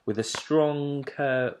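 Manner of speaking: usual speaking voice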